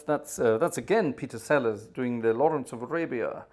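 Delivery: ordinary talk, nothing marked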